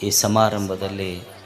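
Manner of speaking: soft, low, devout opening greeting; solemn invocation. Acted. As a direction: call on the guru reverently before the talk.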